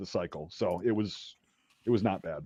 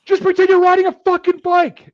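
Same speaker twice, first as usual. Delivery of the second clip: loud, high-pitched yelled instruction; imitated yell; 8 dB louder than usual. Kid yelling advice at recruit stuck on monkey bars, imitated yell. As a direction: yell it across the obstacle course.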